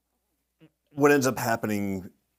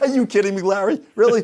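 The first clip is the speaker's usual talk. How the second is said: louder, higher, laughing through it; laughing disbelief; 13 semitones higher, 13 dB louder than usual. Real, not acted. Larry asks for his help.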